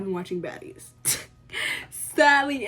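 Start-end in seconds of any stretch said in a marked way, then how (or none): 1.9-2.7 Sadly punched out high and loud; exaggerated loud sadly. Real, not acted. Vlogger laughing at her own show choices, loud mock lament.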